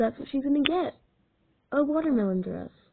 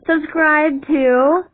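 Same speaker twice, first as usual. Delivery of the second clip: calling out; 4 semitones higher, 8 dB louder, much slower than usual. Acted. Outro call to viewers.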